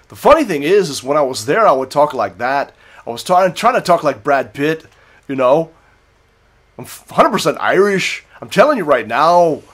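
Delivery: loud, sing-song mock Irish accent; fake Irish accent. Drinker showing off how he talked in the bar, in fake Irish accent. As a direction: put on a corny, lilting Irish brogue. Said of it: "total fake ass Irish accent".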